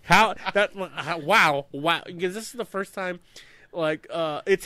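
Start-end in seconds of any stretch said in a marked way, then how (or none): 0.0-2.0 slow, drawn-out disbelief; stunned wow; amazed. Retold or imitated. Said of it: "I was like".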